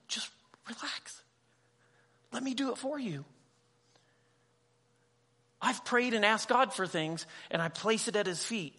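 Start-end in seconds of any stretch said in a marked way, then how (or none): 0.1-3.2 soft, breathy whisper of comfort; gentle reassurance. Retold or imitated. God reassuring the worried believer, softly and gently. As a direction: hush them and take the load. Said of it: "And God's just saying".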